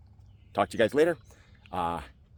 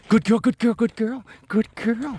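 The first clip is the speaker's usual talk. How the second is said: high praise voice; 9 semitones higher than usual. Real, not acted. Praising dog excitedly.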